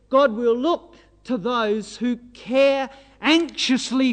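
slow, loud, weighty "care anxiously"; emphatic quotation. Real, not acted. Preacher quoting a translation to young people, emphatic quotation. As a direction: spell out "care anxiously" slowly and forcefully.

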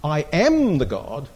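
slow, with "am" held long; unhurried, leaning on "am"; stress on AM. Shown as a demonstration. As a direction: dwell on "am" as the crucial word. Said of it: "He knew which word was crucial".

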